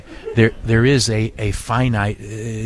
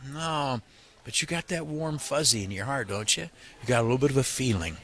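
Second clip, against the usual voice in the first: much softer, leaning into the mic; soft, heartfelt aside to listeners; hushed, intimate; 3 semitones higher, 9 dB quieter than usual. Real, not acted. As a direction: draw them in close with a hush. Host turns intimate about feelings.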